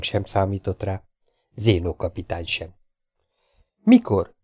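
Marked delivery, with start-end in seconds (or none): 3.8-4.3 sudden sharp high question, eager; excited, much higher and louder. A narrator's reading. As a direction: blurt it out, keen to know. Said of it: "kérdezte izgatottan".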